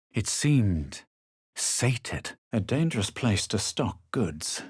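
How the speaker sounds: ordinary talk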